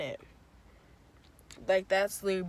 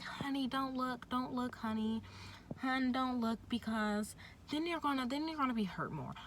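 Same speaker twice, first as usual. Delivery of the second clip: higher, softly coaxing nurse voice; a touch of a soothing nurse; imitating nurses; 6 semitones higher, 6 dB quieter than usual. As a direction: mimic the nurses gently telling her not to look.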